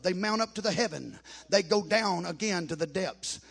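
loud, intoned scripture; preaching scripture. Acted. Preacher proclaiming the psalm to the congregation, loud.

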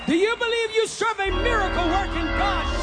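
ordinary delivery

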